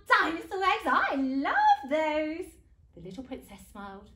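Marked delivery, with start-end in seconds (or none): 0.1-2.5 loud, high enthusiasm; showy storybook relish; gleeful mum. Acted. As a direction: gush with delight, stretching the last words. Mum delights in the eggs.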